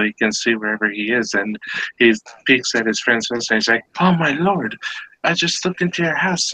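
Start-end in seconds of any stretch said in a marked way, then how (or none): 4.0-6.5 high-pitched mock alarm; acted shock, voice raised high; shocked hunter. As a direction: break startling news to a buddy. Joke hunter spots shocking scene.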